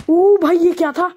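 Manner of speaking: high, loud and drawn-out cry of surprise; startled shout. Real, not acted. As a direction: yelp at the sudden danger. Sudden shock in the game.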